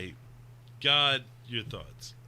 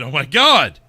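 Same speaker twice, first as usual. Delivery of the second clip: much louder, shouted Oh my God; loud exclamation; 13 dB louder than usual. Shown as a demonstration. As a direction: belt out Oh, my God. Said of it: "I mean".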